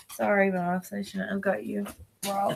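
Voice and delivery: ordinary talk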